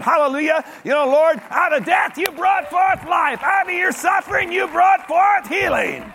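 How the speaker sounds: high, loud preaching cry; preacher praising the Lord, loud and high; shouted praise. Acted.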